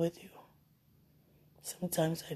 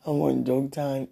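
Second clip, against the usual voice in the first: ordinary talk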